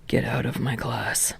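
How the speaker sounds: low, soft dead monotone. A narrator's reading. Exhausted teacher dismissing the students. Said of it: "Aizawa monotones".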